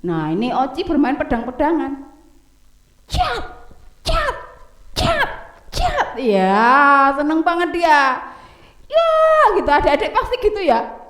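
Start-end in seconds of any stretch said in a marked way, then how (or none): 3.1-6.2 high playful sword-swish cries; sword sounds. Acted. Boy swings his toy sword, high playful swish sounds. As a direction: squeak out toy sword clashes.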